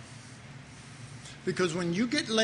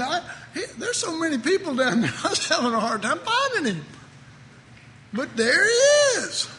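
much higher, startled voice of a God who has only just noticed; clueless God voice; 12 semitones higher than usual. Pretend. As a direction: play God as a dithering old man who has just spotted his son. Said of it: "let's hope God doesn't talk like that".

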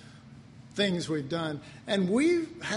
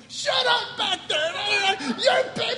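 much higher, loud frantic yell; parents yelling; 14 semitones higher, 3 dB louder than usual. Retold or imitated. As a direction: scream at the kids in the back seat. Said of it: "the parents going".

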